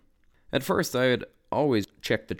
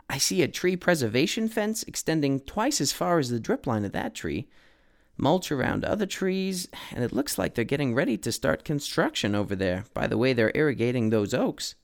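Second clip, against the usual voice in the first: lightly voiced eager assistant, higher; Codit's voice; 5 semitones higher than usual. A narrator's reading. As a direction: point things out keenly. Assistant observing the neighborhood.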